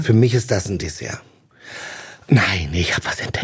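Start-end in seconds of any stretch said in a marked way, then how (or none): 2.3-3.4 conspiratorial whisper. Real, not acted. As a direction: let them in on a secret, breathless. Secret tip, whispered. (geflüstert wie ein Geheimtipp)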